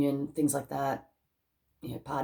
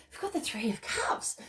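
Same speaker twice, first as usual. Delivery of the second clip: hushed; 5 semitones higher, 6 dB quieter than usual. Real, not acted. Card reveal.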